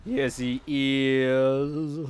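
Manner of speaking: very slow spooky voice; eerie voice. Pretend. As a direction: drag each word out eerily. Continues eerie voice.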